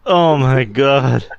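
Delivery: ordinary delivery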